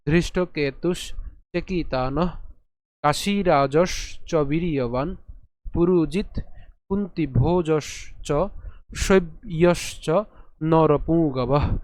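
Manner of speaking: slow verse, a pause after every word; broken-up recitation. Shown as a demonstration. Teacher repeating the shloka for learners, slow broken-up recitation. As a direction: recite piece by piece with drawn-out long vowels. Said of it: "এভাবে ভেঙে ভেঙে যদি উচ্চারণ করি".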